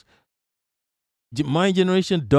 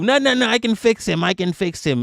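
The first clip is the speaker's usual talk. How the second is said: mock denial; 2 semitones higher than usual. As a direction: play the deluded partner, losing steam. Abused partner in denial again.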